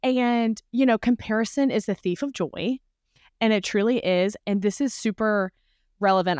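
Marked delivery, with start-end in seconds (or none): none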